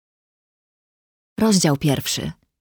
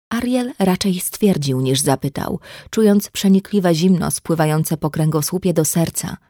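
usual speaking voice

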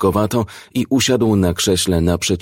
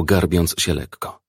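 ordinary delivery

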